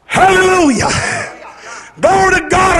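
usual speaking voice

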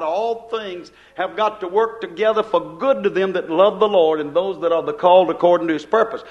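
ordinary delivery

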